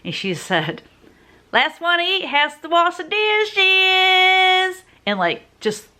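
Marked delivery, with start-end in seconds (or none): 1.5-4.8 high, loud, drawn-out Southern holler; Southern grandma twang. Retold or imitated. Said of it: "in that Southern voice, that Southern twang".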